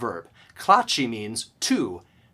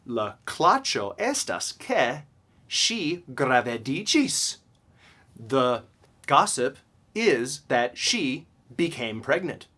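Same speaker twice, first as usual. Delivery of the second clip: slow example reading; slower than usual. Shown as a demonstration. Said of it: "slow example sentence".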